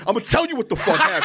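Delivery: high, fast angry outburst to dad; mad rant. Retold or imitated. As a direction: launch into a heated, re-enacted rant. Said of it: "But I'm so mad".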